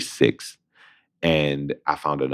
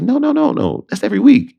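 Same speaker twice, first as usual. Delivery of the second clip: much higher, cheerful correction; a bright mock agent voice; agent correcting, high; 5 dB louder than usual. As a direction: sing out the correction like a delighted agent.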